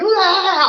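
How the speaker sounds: high, loud "aah" of exaggerated distress; hammed-up cry of upset; mock upset wail. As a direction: wail it, all extra. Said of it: "If I'm super upset".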